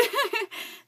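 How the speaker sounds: short, high-pitched burst of real laughter. Real, not acted. Woman laughing at her own tearful moment, high giggly laugh. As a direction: let a high laugh spill out. Laughs after admitting losing it.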